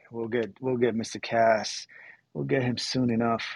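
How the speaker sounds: normal talking voice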